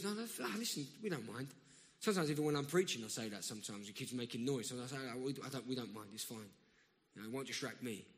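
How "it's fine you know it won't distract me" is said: ordinary talk, nothing marked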